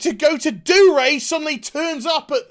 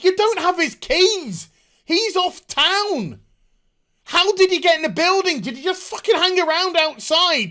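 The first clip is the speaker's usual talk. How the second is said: heated rant; 7 semitones higher, 4 dB louder than usual. Real, not acted. Ranting at plot holes.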